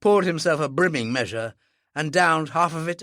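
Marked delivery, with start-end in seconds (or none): none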